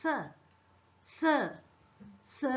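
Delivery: isolated "സ", the sound of S; teacher modelling "സ" as a phonics sound; letter sound of S. Shown as a demonstration. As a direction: say "സ" alone with pauses between. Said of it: "എസ് എങ്ങനാ pronounce ചെയ്യുന്നത്?".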